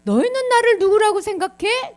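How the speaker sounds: high, loud, pointed question; emphatic questioning. Acted. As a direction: put the question forcefully to the whole group. Jesus puts question to disciples forcefully.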